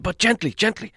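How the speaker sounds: high, loud then softening hobbit voice; hobbit guiding. Acted. Hobbit to the thrower, high urging then gentle. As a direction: urge him to ease it back, softening.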